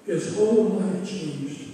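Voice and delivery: slowed down, every word weighed; slow emphatic repeat. Shown as a demonstration. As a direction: repeat it slowly so it sinks in.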